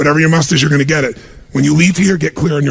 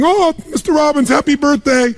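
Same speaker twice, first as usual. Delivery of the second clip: high, breathless imitation of a gushing maid; 6 semitones higher than usual. Maid at home thanking her boss, gushing high voice. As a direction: play the maid, all sweetness and delight.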